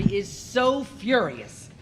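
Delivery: usual speaking voice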